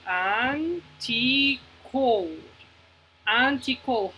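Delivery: "anti-code" stretched into an, ti, code; teacher sounding out "anti-code" for counting; syllable by syllable. Shown as a demonstration. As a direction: let each syllable of "anti-code" ring separately. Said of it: "¿Cuántas sílabas ustedes escuchan".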